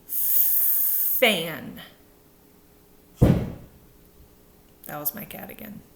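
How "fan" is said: Fan with teeth on lip, air blown through; correct F. Shown as a demonstration. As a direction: model Fan with a clear blown F. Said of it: "here we go".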